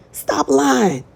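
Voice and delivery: high-pitched mock disbelief; incredulous stop lying. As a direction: throw it back in disbelief. Calling out a liar, repeated.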